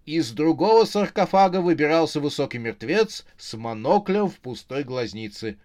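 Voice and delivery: plain reading voice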